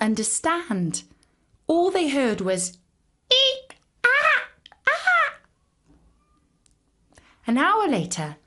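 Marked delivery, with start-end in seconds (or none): 3.3-5.3 very high, loud monkey screech; monkey shriek. A narrator's reading. Officers hear only high, loud monkey shrieks.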